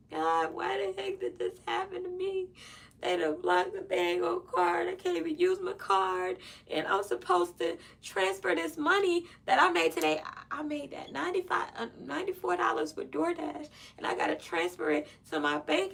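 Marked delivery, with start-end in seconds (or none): 0.1-10.2 retold crying lament.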